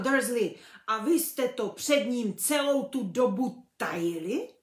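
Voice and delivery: loud furious accusation; trembling with rage. A narrator's reading. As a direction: shake with anger at him. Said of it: "hlas třásl vztekem".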